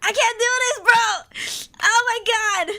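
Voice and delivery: high, loud crying wail; breaking down in tears; real crying outburst. Real, not acted. Streamer overwhelmed by the sad story, crying loudly. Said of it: "I'm a fucking cry tuber".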